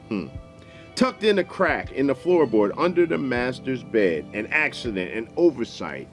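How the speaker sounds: plain reading voice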